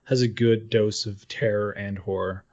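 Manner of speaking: ordinary talk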